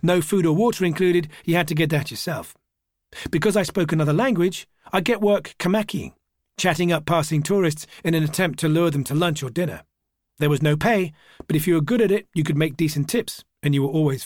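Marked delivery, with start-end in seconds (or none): none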